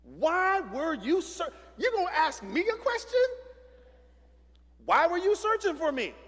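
put-on sassy kid. Mimicking sassy twelve-year-old Jesus.

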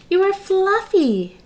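high, slow, amazed reveal; surprised discovery. Acted. Agent Lion discovering Fluffy among the pillows, amazed. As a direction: savor the big reveal.

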